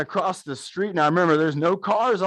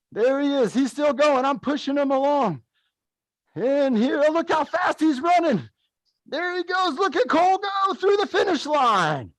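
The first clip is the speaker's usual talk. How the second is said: high-pitched, thrilled finish-line call; excited play-by-play; 9 semitones higher than usual. Real, not acted. Coach narrating his buddy crossing the finish line, excited. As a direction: cheer him home, rising higher and higher.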